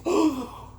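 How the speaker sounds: high-pitched sharp gasp; acted breathy gasp; theatrical gasp. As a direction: gasp as if scandalized. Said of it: "I gasp".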